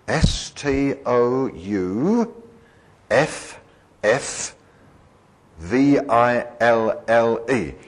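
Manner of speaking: slow letter-by-letter spelling of Stouffville, S T O U F F V I L L E; each letter of Stouffville said slowly and separately; spelled out letter by letter. Shown as a demonstration. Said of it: "the town I live in is called Stouffville".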